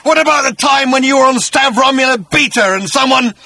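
high, loud furious tirade; ranting Agrajag. Acted. Agrajag blaming Arthur for his vendetta, high ranting shout. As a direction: pour out the grievance at full voice.

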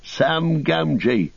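high, slow hailing call; drawn-out call to be recognised; shouted name. A narrator's reading. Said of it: "shouted Sam".

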